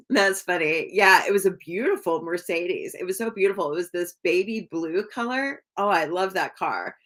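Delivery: usual speaking voice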